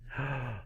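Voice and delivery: short soft gasp of surprise; mock gasp. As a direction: gasp as if caught out. Stages surprise at bad point.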